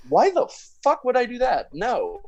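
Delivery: high, indignant question; incredulous retort. Retold or imitated. Friend denying he told Marley's mom, high incredulous retort. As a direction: fire it back in disbelief.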